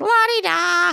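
high, carefree la-di-da; dismissive sing-song. Retold or imitated. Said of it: "I'm kinda like".

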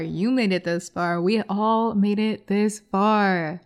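ordinary talk, nothing marked

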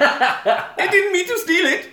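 squeaky, drawn-out child impression; high mock-pleading little-kid voice; Baby Van Damme. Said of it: "Baby Van Damme from Bloodsport".

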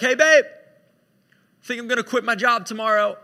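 loud, casual husband voice; breezy husband announcement. Acted. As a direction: call it out cheerily to your wife. Acting Noah breaking news to wife.

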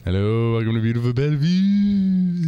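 shifted wrestler promo voice; Dusty Rhodes impression. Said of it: "how to do a Dusty Rhodes impression".

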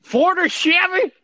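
high, loud, slow disbelief at the question; mock outrage. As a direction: act scandalised anyone would ask.

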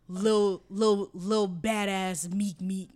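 slow, stretched mock kid's name; mocking drawl. Mother mocking a made-up kid name to the listener, slow and sarcastic. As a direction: savor the ridiculous name sarcastically.

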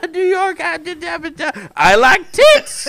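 high, loud excited squeal; excited Charlie Day. Retold or imitated. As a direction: squeal it like an overexcited goof. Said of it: "sounded like a very excited Charlie Day".

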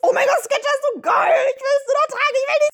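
high squealing fangirl; gushing fangirl. Retold or imitated. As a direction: squeal it like an ad-obsessed teen. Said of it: "wie die Mädels die ganze Zeit sagen".

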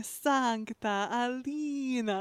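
very slow mock Russian accent; bad Russian accent. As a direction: stretch it out in a fake Russian accent. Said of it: "you just hear this woman going".

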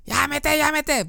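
high, pleading mock yells; imitating begging to stop. Spun patient begging staff to stop, high mock pleading. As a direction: beg them to stop in a strained high voice. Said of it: "And he's like".

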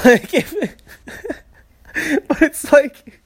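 high, breaking into laughter; laughing. Real, not acted. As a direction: try to talk through real giggles. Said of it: "I'm sorry for laughing".

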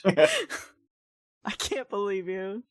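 laughing through the words; can't stop laughing. Real, not acted. Laughing through mock outrage.

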